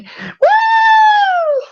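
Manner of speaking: high whooping yell; excited whoop. Acted. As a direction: let out a loud, high whoop. NPC sprinting off, whooping.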